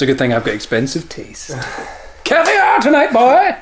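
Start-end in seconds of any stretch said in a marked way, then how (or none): 2.2-3.6 gloating. Real, not acted. Gloating over winning.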